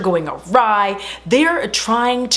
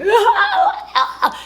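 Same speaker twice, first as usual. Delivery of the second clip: hysterical laugh. Retold or imitated. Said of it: "She's like".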